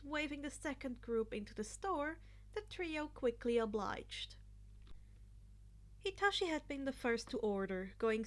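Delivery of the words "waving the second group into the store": ordinary delivery, nothing marked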